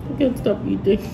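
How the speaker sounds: usual speaking voice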